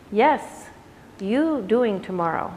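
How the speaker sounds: put-on light Indian accent, slow and gentle; Pattabhi Jois accent. Quoting Pattabhi Jois to students in a light Indian accent. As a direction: lean lightly into an Indian accent as the old teacher. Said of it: "I did it with my accent".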